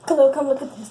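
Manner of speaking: loud, insistent summons; urgent calling over. Acted. Vlogger calls friend to creepy slime, loud and urgent. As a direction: call a friend over right now.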